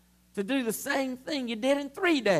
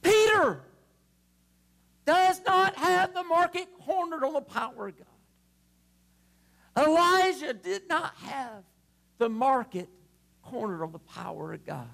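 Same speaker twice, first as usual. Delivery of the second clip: fiery pulpit build; preaching; 6 semitones higher than usual. Acted. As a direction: preach it out to the congregation. Preaching at full force.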